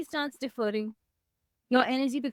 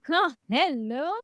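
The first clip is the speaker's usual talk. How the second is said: mock disbelief; 4 semitones higher than usual. Mock disbelief at her old self.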